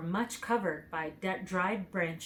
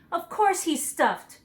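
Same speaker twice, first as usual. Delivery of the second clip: higher, louder, slow cross reply; still angry; 9 semitones higher, 6 dB louder than usual. A narrator's reading. Dorothy answers the lion, still angry. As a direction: snap back, still cross. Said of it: "who was still angry".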